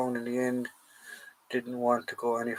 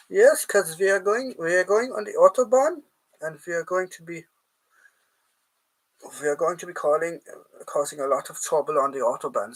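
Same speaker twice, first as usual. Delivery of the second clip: mock German accent, pitched higher; 5 semitones higher than usual. Host joking about the Autobahn in a mock German accent. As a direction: play up a jokey German accent. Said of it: "for having my German accent".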